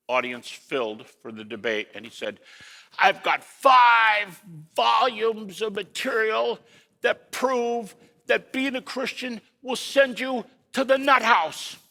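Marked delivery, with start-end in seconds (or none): 3.0-11.8 gruff debater's voice, raised high and drawn out; slow, high-pitched, gruff proclamation; Albert Ellis. Retold or imitated. Quoting a gruff psychologist to a debate audience, gruff and slow. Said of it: "He had a very gruff voice".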